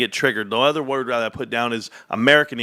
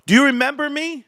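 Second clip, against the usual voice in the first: much higher, triumphant "Do you remember me?" with "you" hit; taunting call-out; 12 semitones higher than usual. Acted. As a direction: gloat at the doubters. Calling out to doubters.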